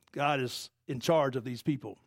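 ordinary talk, nothing marked

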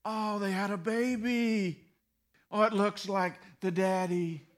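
cooing women. Retold or imitated. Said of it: "when the women get together".

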